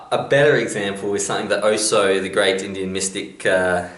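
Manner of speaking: ordinary delivery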